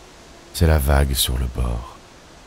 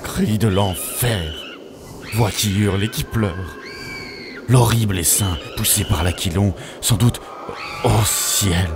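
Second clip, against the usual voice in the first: high-pitched, theatrical cry of horror; dramatic dread; 4 semitones higher than usual. Acted. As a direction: declaim it as the demons close in, pitch raised. Climax of poem, demons attack house. (déclamation horrifiée, voix plus aiguë)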